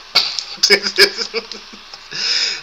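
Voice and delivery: long high laugh; drawn-out embarrassed laugh. Real, not acted. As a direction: laugh at how bad you sound. Laughing at own bad sound.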